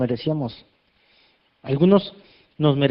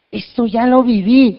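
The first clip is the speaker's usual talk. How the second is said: delighted exclamation, louder and higher, slowed; excited; 7 semitones higher, 7 dB louder, slower than usual. Retold or imitated. Preacher acting the congregation's joy, excited. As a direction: savor the happy discovery. Happy people recalling a moment.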